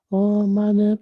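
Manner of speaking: high, tiny chanting voice; tiny child's voice. Retold or imitated. Teacher imitating kids saying the mantra, tiny high chant. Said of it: "tiny voice".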